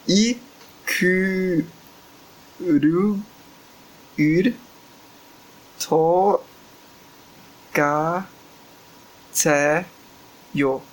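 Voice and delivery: halting, each syllable alone after a long pause; syllable-by-syllable reading. Shown as a demonstration. Reading the Korean message to listeners, halting syllable by syllable. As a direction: sound out each syllable very slowly. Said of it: "Agora eu vou ler a mensagem pra vocês".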